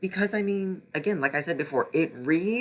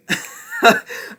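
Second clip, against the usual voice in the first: disbelieving laugh; 6 dB louder than usual. Real, not acted. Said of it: "laughing at the book".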